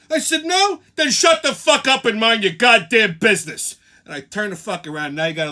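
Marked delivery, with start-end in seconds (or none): 0.4-3.8 angry yell. Retold or imitated. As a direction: shut her up hard. Telling the woman off.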